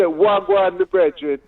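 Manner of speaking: Jamaican patois, pitch leaping up on "brethren"; "Wagwan, my brethren" in broad Jamaican patois; Jamaican patois greeting. Shown as a demonstration. Presenter daring the host to talk Jamaican, in patois. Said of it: "talk with a Jamaican accent".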